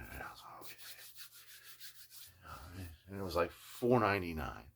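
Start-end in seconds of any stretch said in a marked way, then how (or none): 0.0-2.9 hushed, breathy aside; whisper. Real, not acted. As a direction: drop to a whisper. Unvoiced whisper.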